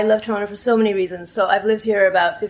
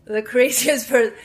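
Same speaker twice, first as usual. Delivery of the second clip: normal talking voice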